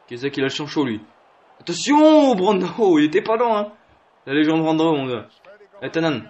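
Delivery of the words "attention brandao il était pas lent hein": much higher, loud warning yell; high-pitched thrilled call; excited shout. Real, not acted. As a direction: cry out as he breaks away. Tense moment in match.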